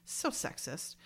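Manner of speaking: a soft unvoiced aside under the breath; muttered aside. Real, not acted. Podcaster muttering about the sexist script, breathy and soft. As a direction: mutter it to yourself, half-joking.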